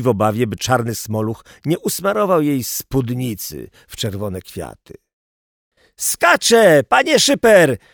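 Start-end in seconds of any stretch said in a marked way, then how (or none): 6.0-7.8 high, loud warning shout; high-pitched urgent yell; boy calling out. A narrator's reading. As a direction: holler the news up to the boss. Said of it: "zawołał chłopak".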